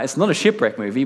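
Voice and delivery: ordinary delivery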